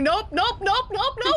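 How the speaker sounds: high, repeated mock-panic refusal; mock screaming. Player fleeing the Titan like a scaredy cat, mock screaming. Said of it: "And I'm just screaming".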